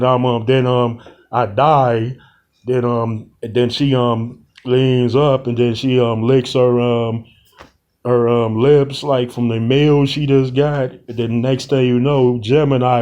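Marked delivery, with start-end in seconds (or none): none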